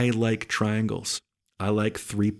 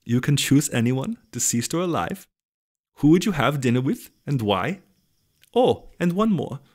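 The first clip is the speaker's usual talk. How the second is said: put-on worst Dutch accent; 5 semitones higher than usual. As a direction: read it out in an awful mock Dutch accent. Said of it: "that was the worst accent".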